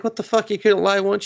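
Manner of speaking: exasperated, can't believe her. Retold or imitated. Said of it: "And he's just like".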